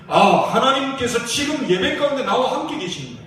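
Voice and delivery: fiery pastor rallying the congregation; preaching at full force. Acted. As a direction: drive it home to the church like a revival preacher.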